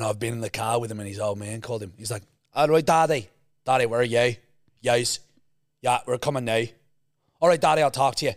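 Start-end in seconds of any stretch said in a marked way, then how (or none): 2.5-8.3 imitated tough Northern Irish son on the phone; Northern Irish accent. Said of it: "in Northern Ireland".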